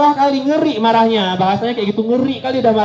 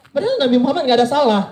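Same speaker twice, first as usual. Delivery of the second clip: ordinary talk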